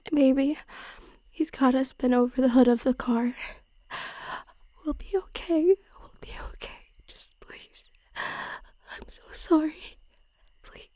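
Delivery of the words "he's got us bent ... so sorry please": frightened hushed whisper; trembling whisper. Acted. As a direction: whisper it, scared and shaky. Frightened whisper while held down.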